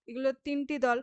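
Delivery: ordinary talk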